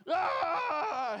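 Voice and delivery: loud high frustrated yell; inner scream of rage. Retold or imitated. Pastor's inner furious yell over the voided warranty. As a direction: let out a howl of anger. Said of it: "And inside, I'm like".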